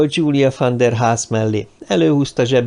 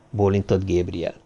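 ordinary delivery, nothing marked

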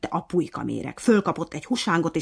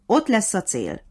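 called out; 5 semitones higher, 6 dB louder than usual. A narrator's reading. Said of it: "kiabált a nyúl".

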